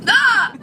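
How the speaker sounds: high honking hiccup; dying goose hiccup. Real, not acted. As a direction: honk like a goose.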